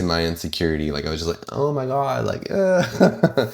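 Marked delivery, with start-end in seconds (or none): 1.5-3.5 high mortified squeak; embarrassed squeak. Retold or imitated. Embarrassed reaction retold.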